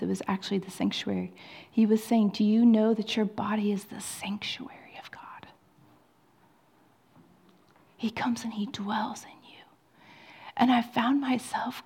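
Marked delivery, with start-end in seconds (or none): 2.3-9.6 whispered preaching. Acted.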